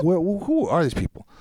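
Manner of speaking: startled, high-pitched retold question; acted alarm. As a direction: replay being thrown by armed strangers. Retelling alarm at guns in China.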